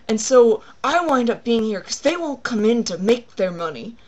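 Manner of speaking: hammed-up aggrieved rant; freaking out. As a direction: imitate an exasperated worker on a tirade. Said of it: "she starts freaking out".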